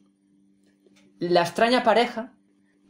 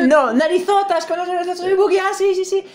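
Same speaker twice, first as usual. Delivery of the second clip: much higher, loud, whiny cartoon clown; Buggy voice; 11 semitones higher, 6 dB louder than usual. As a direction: squeal as the offended clown. Voicing Buggy meeting Luffy.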